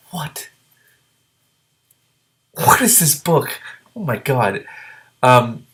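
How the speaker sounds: high, startled disbelief; real surprise at the page; surprised reaction. Real, not acted. Reader reacts to the book.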